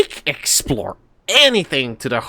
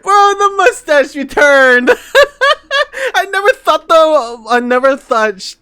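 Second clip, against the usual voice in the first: laughing; 13 semitones higher, 8 dB louder than usual. Real, not acted. Laughing at mustache returning.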